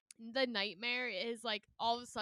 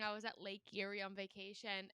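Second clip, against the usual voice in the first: ordinary delivery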